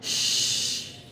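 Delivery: Shh hissed softly with air spread across the mouth; shin hiss. Shown as a demonstration. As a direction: blow a soft spreading Shh. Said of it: "Angin perlu untuk dibuat nyebar".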